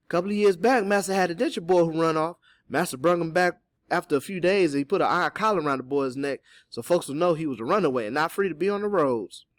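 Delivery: animated character recounting the past; Teeny's storytelling. Acted. Voices Teeny telling a story.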